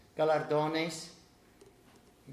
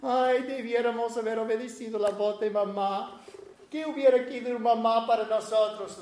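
hermanas lamentándose; 5 semitones higher than usual. Retold or imitated. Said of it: "mis hermanas estaban".

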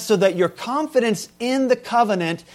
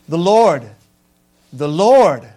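"The Lord, the Lord" called out louder, each falling; proclaimed name; 7 dB louder, slower than usual. Shown as a demonstration. Preacher proclaims God's name to the congregation. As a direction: proclaim the name twice with weight. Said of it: "He starts out by saying his name twice".

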